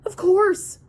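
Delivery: sharp, loud, high snap; snapping. Acted. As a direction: snap it back in contempt. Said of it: "I snapped".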